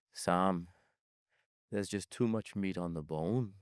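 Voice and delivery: low, quiet Irish accent, badly faked; shitty Irish accent. Business contact refusing Sam's pitch, in a mock Irish accent. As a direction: imitate a thick Irish accent, soft and low. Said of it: "a pretty shitty Irish accent".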